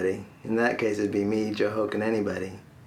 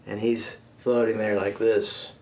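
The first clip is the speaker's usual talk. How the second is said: normal talking voice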